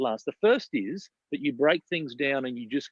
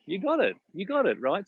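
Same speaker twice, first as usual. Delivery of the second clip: ordinary talk, nothing marked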